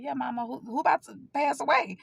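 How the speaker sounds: high, hesitant questioning; uneasy question. Retold or imitated. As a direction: imitate a daughter dreading the answer. Voices own worried question to mother.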